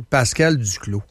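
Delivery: usual speaking voice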